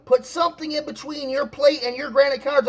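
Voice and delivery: high, fussy imitation of a landlady; stressing landlady. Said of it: "she was stressing about".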